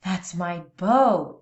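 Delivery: slow, loud, proud mama cry. A narrator's reading. Mama armadillo hearing the commotion, slow loud cry. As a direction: call out for her son, half proud, half worried. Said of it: "she cried".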